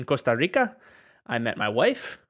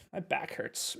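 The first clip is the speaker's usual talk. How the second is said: whisper; 12 dB quieter, slower than usual. Shown as a demonstration.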